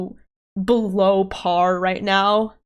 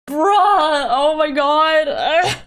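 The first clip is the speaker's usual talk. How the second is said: exasperated outburst; 6 semitones higher, 4 dB louder, slower than usual. Real, not acted. Frustrated streamer can't figure it out.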